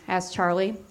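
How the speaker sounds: ordinary delivery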